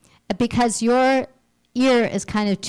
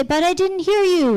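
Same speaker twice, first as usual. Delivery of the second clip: much higher, defensive whine; defensive high protest; 11 semitones higher, 3 dB louder than usual. Retold or imitated. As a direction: plead innocence in a high voice. Acted quote, defending oneself.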